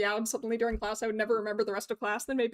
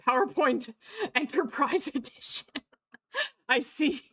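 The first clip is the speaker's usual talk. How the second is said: words breaking up with laughter; real laughing; 3 semitones higher than usual. Real, not acted. Streamer reads a chat joke, laughing through it. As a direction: crack up while reading it out. Cracks up reading viewer's joke.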